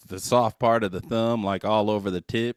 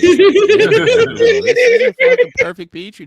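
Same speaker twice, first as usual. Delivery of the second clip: laughing; 12 dB louder than usual. Real, not acted. Laughing through his own joke.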